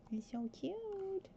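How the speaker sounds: high, soft, drawn-out coo. Real, not acted. Player fussing over a baby in a onesie, cooing. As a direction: squeal softly over something adorable.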